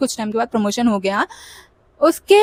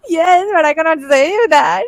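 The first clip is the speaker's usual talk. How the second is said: mock whiny confession; 4 semitones higher, much slower than usual. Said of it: "And I was like".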